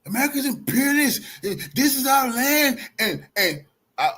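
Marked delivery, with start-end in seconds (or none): 0.1-2.8 mock protester. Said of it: "I'm listening to their speech".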